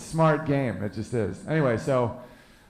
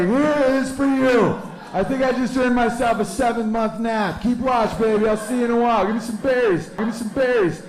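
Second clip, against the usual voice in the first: higher, louder smug bear; proud bear voice; 8 semitones higher, 5 dB louder than usual. Acted. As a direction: brag like a bear bringing home dinner. Bear talks to his woman.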